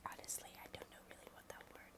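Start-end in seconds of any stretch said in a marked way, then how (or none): none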